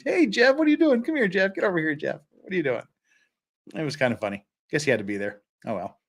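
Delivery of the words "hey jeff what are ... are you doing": much higher pet-talk call that fades back down; calling the dog. Retold or imitated. Greeting the friend's game dog on stream, high pet talk. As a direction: chirp hello to the dog, then settle. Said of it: "was like".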